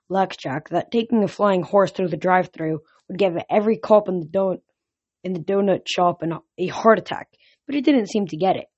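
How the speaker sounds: usual speaking voice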